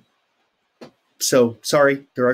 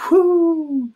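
playful whoo; 5 dB louder than usual. Real, not acted. Playful cheer.

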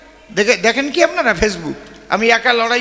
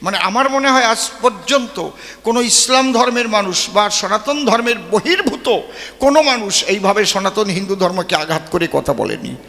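normal talking voice

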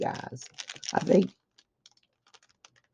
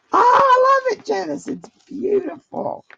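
high, gushing squeal of delight; thrilled high-pitched gush; 12 semitones higher than usual. Real, not acted. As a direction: squeal over the present, high up. Delighted squeal over gift.

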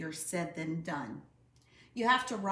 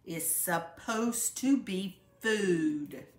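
slow roar; 4 dB louder, slower than usual. A narrator's reading. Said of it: "roared Papa".

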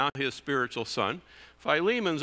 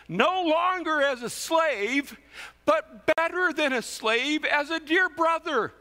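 rising fervour; 13 semitones higher, 4 dB louder than usual. Acted. Climax of the read verse.

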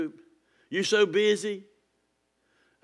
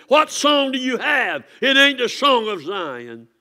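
preaching shout; 7 semitones higher, 6 dB louder than usual. Real, not acted. Preaching peak, slow and high.

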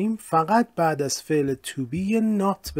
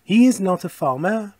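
slow model sentence; 5 semitones higher, 5 dB louder, slower than usual. Shown as a demonstration. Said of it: "مثلاً".

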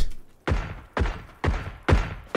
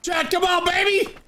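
very high cheering yell. Real, not acted. Cheering a teammate.

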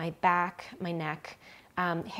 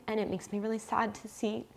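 emotional, near tears; 4 semitones higher than usual. Real, not acted. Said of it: "sorry that it makes me so emotional".

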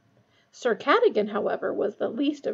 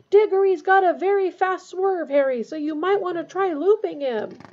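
high, loud calling-out; shouting after him; 8 semitones higher, 6 dB louder than usual. A narrator's reading. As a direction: shout advice to someone running off. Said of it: "with Wood shouting after him".

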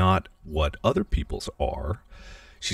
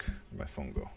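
much softer whispered question to herself; a whisper while searching for her phone; whispered aside; 5 semitones lower, 13 dB quieter than usual. Real, not acted. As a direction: whisper it under your breath. Muttered aside looking for phone.

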